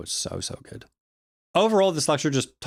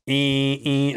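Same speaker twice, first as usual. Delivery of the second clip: higher, held "eh" droning on one note; imitated tonal noise; 5 semitones higher, 4 dB louder than usual. Shown as a demonstration. Lecturer illustrating a single band of noise, imitated tone. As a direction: mimic a steady electronic whine. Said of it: "sort of sound".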